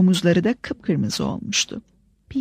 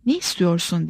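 usual speaking voice

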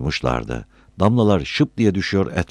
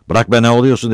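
raised, urgent remonstrance; loud intervention; 7 semitones higher, 7 dB louder, faster than usual. A narrator's reading. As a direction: break up the fight. Bystander breaks up acted quarrel.

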